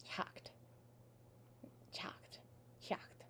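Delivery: hakt, very soft and barely voiced; barely pronounced. Shown as a demonstration. Teacher showing what happens to that T, barely pronounced. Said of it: "you barely pronounce it at all".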